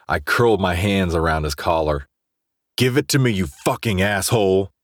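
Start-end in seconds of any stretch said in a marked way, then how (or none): 2.8-4.7 angry shout. Acted. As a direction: yell it in his face. Grabbing friend's collar, drunk.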